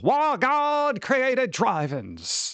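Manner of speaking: high, slow preacher voice; evangelist preacher. Shown as a demonstration. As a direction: proclaim it like a TV evangelist. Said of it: "You gotta say it like an, an evangelist".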